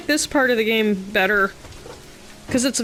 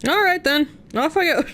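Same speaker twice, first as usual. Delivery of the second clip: mock leaving; 6 semitones higher than usual. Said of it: "jokes about leaving".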